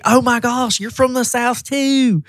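high, eager "oh my gosh"; mock thrilled stranger; a lightly voiced delighted Southern lady; excited Southern greeting. Retold Alabama woman's excited greeting.

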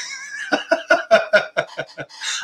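breathy, voiceless fit of laughing; helpless laughter. Real, not acted. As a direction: crack up until no voice comes out. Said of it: "I'm nearly crying with laughter".

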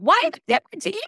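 high, loud, exasperated question; retold indignant challenge. Retold quarrel with the doctor.

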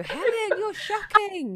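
high, loud teasing scold; mock outrage. Real, not acted. Teasing Helen for not knowing Bradley Cooper, mock outraged. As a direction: tease her for not knowing him.

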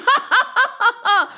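high staccato evil cackle; villainous laugh. A narrator's reading. Unseen serpent laughs by the dark river, villainous. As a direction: laugh like a gloating monster. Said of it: "there was somebody who was laughing".

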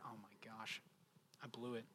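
low, very soft realization; hushed regret. Retold or imitated. Husband seeing his wife cry, quietly regretful. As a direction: drop to a near-murmur of guilt.

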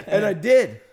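gleeful telling that bursts into big laughter; laughing. Real, not acted. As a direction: break into a loud, high laugh. Cracks up telling his revenge.

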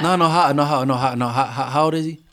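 loud, rapid-fire stammering question; can't get the question out fast enough; excited pushback. Real, not acted. Co-host pressing mom about her son, excited and loud.

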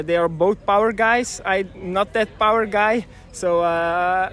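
normal talking voice

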